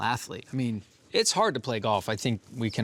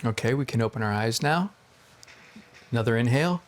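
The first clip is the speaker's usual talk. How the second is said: therapist; faster than usual. Pretend. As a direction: guide the breath like a therapist. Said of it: "Pretend that I'm your therapist".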